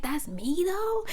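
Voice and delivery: mock amazement rising high at the end; exaggerated disbelief. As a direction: swoop up into a hammed-up question. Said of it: "I didn't say it like that".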